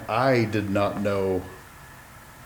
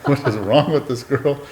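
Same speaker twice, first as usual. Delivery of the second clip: laughing; 5 semitones higher, 7 dB louder than usual. Pretend. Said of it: "mock thought, laughing".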